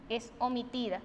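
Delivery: normal talking voice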